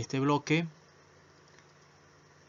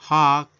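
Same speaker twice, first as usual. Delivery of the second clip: Hot, shouted out loud and hard; emphatic model word; 9 dB louder than usual. Shown as a demonstration. Teacher stressing Hot for the class, loud. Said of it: "se divide en dos partes".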